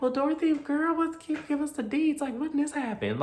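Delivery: high, loud mock excited prying; playfully squealed girl talk; nosy girlfriends. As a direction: voice eager friends digging for gossip. Friends press her for gossip.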